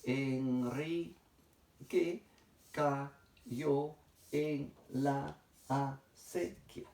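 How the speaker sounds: very slow, each syllable split apart. Shown as a demonstration. Teacher splitting a sentence into syllables, very slow. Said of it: "voy a separarlo de esta manera".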